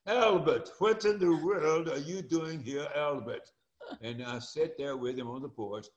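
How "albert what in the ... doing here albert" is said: put-on a light English accent, a bit higher and louder; English accent, lightly imitated; imitating an English accent. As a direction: mimic Stuart's English accent, friendly surprise. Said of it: "He's got that English accent".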